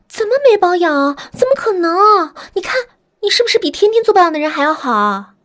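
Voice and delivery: high, loud, eager flattery; gushing sales pitch. Acted. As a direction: gush over her hands like a saleswoman. Manicurist flattering a customer.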